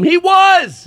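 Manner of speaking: high, loud burst of certainty; exclaimed. Real, not acted. Insisting to friends that he really was a problem, loud and high. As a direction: shoot it up in outrage. Defending Sinead's warning.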